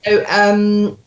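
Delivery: ordinary delivery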